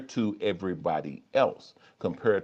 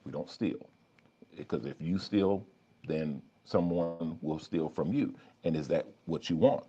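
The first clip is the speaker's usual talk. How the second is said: a calm inner voice talking you out of stealing; quiet conscience; 3 semitones lower, 5 dB quieter than usual. Pretend. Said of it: "Versus the other voice that said".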